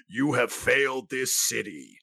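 slow, dramatic lightning round voice; crackling lightning voice. Shown as a demonstration. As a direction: growl it out slowly like a vigilante. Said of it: "In my lightning round voice".

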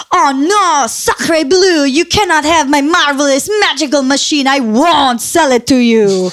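overdone French chef voice. Retold or imitated. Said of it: "he'd be like".

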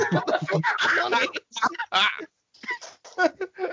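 can't believe it, laughing; laughing disbelief. Real, not acted. Mocking a friend's random picks, laughing in disbelief.

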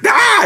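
very high sudden shriek; imitated villain's scream; mock scream. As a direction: yelp out of nowhere, way up high. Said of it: "when he screamed at this".

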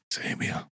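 soft unvoiced bedtime whisper. Sleepy guest whispers, lulling the host.

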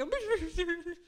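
high-pitched comic blubber; mock blubbering. Mom acting out crying over the dishes, mock blubbering. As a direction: imitate yourself blubbering like a fool. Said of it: "I've become this blubbering, crying fool".

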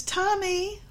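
high, rising singsong call of the name. Shown as a demonstration. Mother calling her son in a sweet singsong. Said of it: "the emphasis in the tone of voice".

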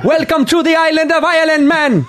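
high, Jamaican-sounding Irish welcome; singsong fake Irish gone Jamaican; botched Irish accent. Shown as a demonstration. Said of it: "that sounds so Jamaican".

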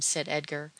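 ordinary delivery

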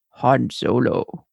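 low, slow masked villain voice; hollow talking mask. Retold or imitated. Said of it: "mask voice".

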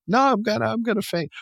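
high, whiny mock protest; whiny fainting husband. Husband whining to his wife he'll faint, high and squeaky. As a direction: squeal it like a wimp. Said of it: "he's like".

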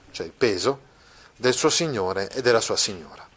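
normal talking voice

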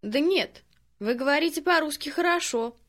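ordinary delivery

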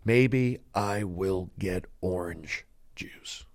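hammed-up hushed soliloquy; dramatic whisper. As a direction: whisper it slowly, word by word. Repeats line as hushed drama.

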